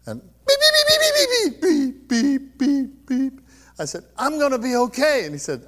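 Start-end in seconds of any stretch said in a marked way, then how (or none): 0.5-3.4 loud, high, rapid-fire beeps settling into a steady beat; heart monitor restarting. Retold or imitated. Imitates heart monitor starting again. 4.2-5.2 loud, high exclamation of relief; excited relief. Acted. Retold cry of relief on revival.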